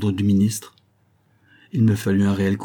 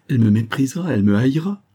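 higher anguished outcry, climbing at the end; horrified exclamation; 4 semitones higher, 4 dB louder, faster than usual. A narrator's reading. Lover dreading the woman's scorn, horrified exclamation. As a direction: cry it out, pitch swinging upward. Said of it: "s'écria-t-il".

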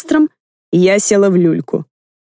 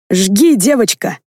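1 gleeful shout; slower than usual. A narrator's reading. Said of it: "закричал дед".

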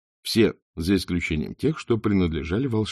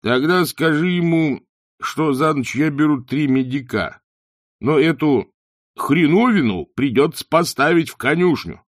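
loud, raised-pitch demand; big-voiced landlord stating his price; blustering innkeeper; 7 semitones higher, 5 dB louder than usual. A narrator's reading. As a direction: lay down the terms forcefully. Innkeeper states his terms loudly.

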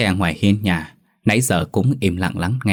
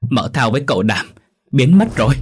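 alarmed report, rising to high and loud; lắp bắp hoảng hốt; 5 semitones higher, 5 dB louder than usual. A narrator's reading. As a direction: break bad news in a panic. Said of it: "Con Gạo lắp bắp". (giọng hớt hải)

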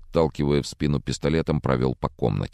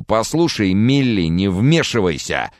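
louder, higher, slow warning; angry warning; 6 semitones higher, 5 dB louder than usual. Acted. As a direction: push her away with your voice. Drunk man warns off Camilla. (злое предупреждение, громче и медленно)

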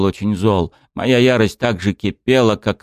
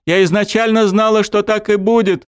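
high, loud tearful scream; 13 semitones higher, 3 dB louder than usual. A narrator's reading. Dryad screaming accusations at the god of death. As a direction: scream it at him with everything. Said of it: "кричала она".

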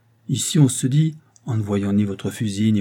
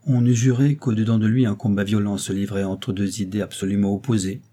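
ordinary delivery

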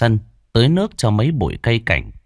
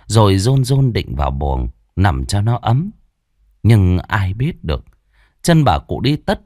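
plain reading voice

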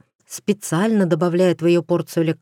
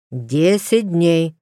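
slow, stern, each word weighed; «Десять дней» slowly, stress on десять; stern reminder; much slower than usual. A narrator's reading. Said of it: "строго напомнил".

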